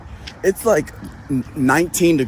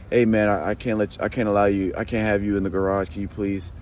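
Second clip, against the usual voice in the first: lightly voiced polite guard, softer; polite request; 6 semitones lower, 4 dB quieter than usual. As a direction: ask nicely, a touch hesitant. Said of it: "you could ask me nicely".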